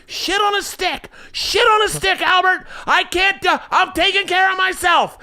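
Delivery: high-pitched imitated wailing; motion-sick mom. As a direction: wail curses like a panicking mother. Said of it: "closes her eyes and starts going".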